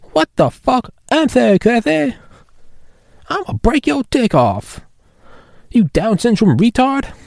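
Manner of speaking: very high cartoon voice; South Park voice. Muse cursing at Classy, very high cartoon voice. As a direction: screech it like a cartoon kid. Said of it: "God, I love South Park".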